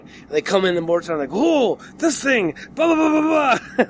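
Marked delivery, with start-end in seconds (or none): 1.3-3.8 mock overacting actor. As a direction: overdo the emotion like a bad actor. Mocking over-emotional actor.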